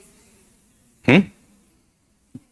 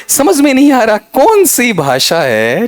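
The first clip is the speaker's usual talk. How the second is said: exasperated teasing; 10 semitones higher, 3 dB louder, slower than usual. Real, not acted. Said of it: "teasing the student".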